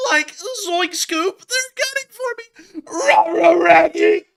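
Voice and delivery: high, loud cartoon squeal; panicked Shaggy-and-Scooby yelping, stretched out; Scooby-Doo impression. Narrator mocking a fleeing soldier's run, Scooby-Doo style, high and loud. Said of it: "do that Scooby-Doo run".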